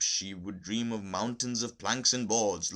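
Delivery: plain reading voice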